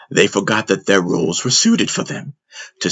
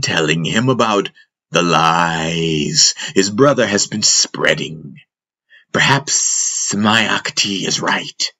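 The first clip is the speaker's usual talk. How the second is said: Jandi the snake; 2 semitones higher than usual. Acted. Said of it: "The master's rendition of Jandi's voice".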